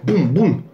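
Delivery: onomatopée de frappe lancée plus haut; bruitage de coups. Retold or imitated. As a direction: mime le gauche-droite à la voix. Mime les coups de poing. (petit bruitage de coups de poing)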